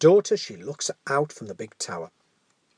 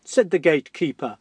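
ordinary delivery, nothing marked

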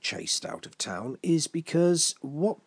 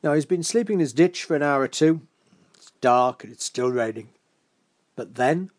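usual speaking voice

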